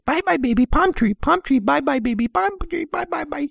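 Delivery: put-on very high sing-song coo to a baby; cooing mother. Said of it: "the mother, kept, like, looking out the window going".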